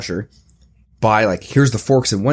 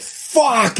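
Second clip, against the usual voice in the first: high-pitched drawn-out curse; retold yell of agony; 12 semitones higher than usual. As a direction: yell it out in pain. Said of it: "I'm just yelling at this point".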